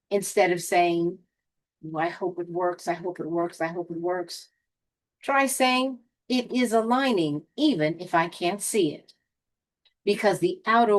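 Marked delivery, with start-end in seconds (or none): 1.8-4.5 quick, hushed repeated wish under the breath; soft, monotone self-talk; anxious muttered hoping. Acted. 6.3-9.1 firm, composed self-affirmation; assured affirmation. Acted. Models confident affirmation against anxious hoping.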